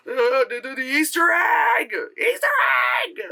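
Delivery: high-pitched hammed-up babbling squeal; mocking fanboy excitement; mock over-excitement. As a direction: stammer into an exaggerated excited squeal. Mocks film pointing out Easter eggs.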